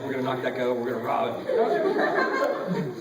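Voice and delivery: low slow hustler impression; mock thug. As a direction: drag it out like a menacing mugger. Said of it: "thought they were hustling me like".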